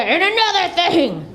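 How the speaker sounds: high cigarette-on-the-lip nagging; yapping mom. Retold or imitated. Mom yapping at her kids, high nagging. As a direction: yap it as if holding a cigarette in your lips. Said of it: "Just fucking yapping at ya".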